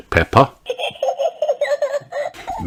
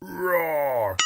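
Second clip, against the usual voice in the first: a little boy's mock dinosaur roar; toddler roar; 10 semitones higher, 9 dB quieter than usual. George playing dinosaur, small mock roar. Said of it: "cries George".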